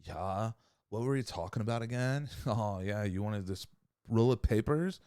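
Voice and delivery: lazy, soft stoner voice; lightly voiced spaced-out stoner; stoner dude. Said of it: "talking like a stoner dude".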